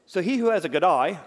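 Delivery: very loud, high mock-Australian reading; Australian accent. Preacher reading Proverbs as if Australian, booming Australian accent. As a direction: proclaim it in a hammed-up Aussie accent. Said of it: "God's Australian".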